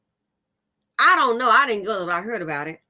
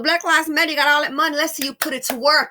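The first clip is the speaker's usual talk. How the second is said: loud, raised challenge; loud call-out; 6 semitones higher, 8 dB louder, faster than usual. Real, not acted. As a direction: call them out loudly.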